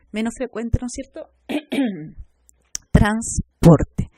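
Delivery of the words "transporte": Transporte loud, in separate syllables. Shown as a demonstration. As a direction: punch out Transporte beat by beat. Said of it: "Vemos acá dos consonantes".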